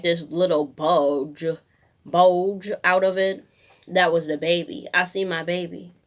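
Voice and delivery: ordinary talk, nothing marked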